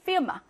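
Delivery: 피르마 with a quick, short i; short vowel. Shown as a demonstration. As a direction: say 피르마 with the first vowel clipped. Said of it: "짧게".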